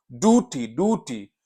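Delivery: ड्यूटी को डूटी बोलें, बिना या के; ड्यूटी में ऊ की आवाज़, या नहीं; American duty. Shown as a demonstration. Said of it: "ऊ है, अब इसमें या नहीं है". (अमेरिकी उच्चारण: ड्यूटी = डूटी)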